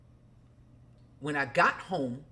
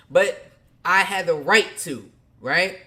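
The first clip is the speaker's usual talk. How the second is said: ordinary talk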